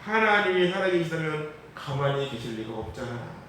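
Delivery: ordinary talk, nothing marked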